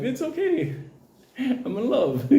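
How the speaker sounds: high, giddy declaration broken by a laugh; acting head over heels, laughing; giddy lovestruck. Lovestruck, calling out to a bully.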